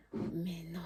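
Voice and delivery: low-volume, barely voiced denial; hushed disbelief. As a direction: whisper it to yourself, not believing it. Incrédule, première réaction rejouée. (incrédulité chuchotée)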